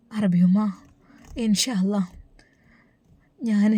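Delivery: barely breathed whisper; whispered hesitation. Acted. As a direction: whisper it, unsure and afraid. Hesitant daughter's reply.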